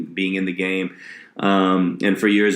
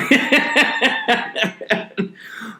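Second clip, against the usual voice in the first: laughing; 14 semitones higher than usual. Real, not acted. Laughs at own bad shows.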